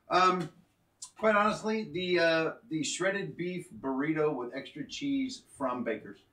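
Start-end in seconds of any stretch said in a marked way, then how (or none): none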